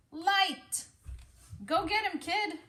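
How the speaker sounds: high, loud rallying shout; rallying cry. Acted. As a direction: cheer the kid on. Firefly leader cheers.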